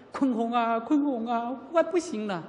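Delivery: high-pitched distraught mother, acted out; imitated wailing. Nun retells mother's emergency night call, imitated wailing. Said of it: "她放声大哭".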